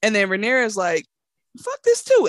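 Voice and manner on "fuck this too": much higher and louder, storming off; furious exit. Retold or imitated. Princess storming out after her friend's betrayal. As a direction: throw it over your shoulder as you leave.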